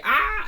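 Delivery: short high scream. Acted. All scream as the tower flies at them, short scream. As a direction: cry out loudly in acted alarm. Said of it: "they all scream".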